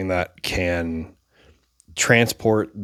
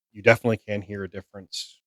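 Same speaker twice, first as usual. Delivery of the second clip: ordinary talk, nothing marked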